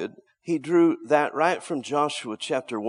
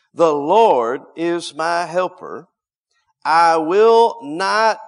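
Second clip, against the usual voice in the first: loud, slow, emphatic declaration; bold confession; 5 semitones higher, 10 dB louder, slower than usual. Shown as a demonstration. Preacher modelling the confession of faith for listeners, boldly. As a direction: proclaim each word slowly at full voice. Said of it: "but to be boldly spoken".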